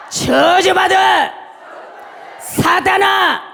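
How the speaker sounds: loud, high, slow commanding shout; full-force deliverance prayer. Real, not acted. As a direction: shout it phrase by phrase for the congregation to repeat. (힘껏 부르짖는 축사 선포 기도)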